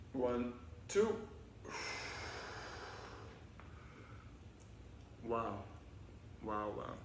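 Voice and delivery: soft, low, hushed cool-down voice; guided breathing. Acted. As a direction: ease the kids into calm breaths. Leads calming breathing cool-down.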